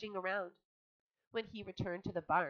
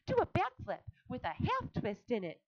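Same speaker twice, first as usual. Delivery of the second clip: higher, mildly loud call; cried out; 6 semitones higher, 3 dB louder than usual. A narrator's reading. Charlotte coaching Wilbur, lightly raised. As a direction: cry the order. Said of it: "cried Charlotte".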